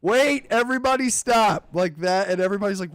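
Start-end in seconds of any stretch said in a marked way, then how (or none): 0.0-1.7 mock call. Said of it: "he's like".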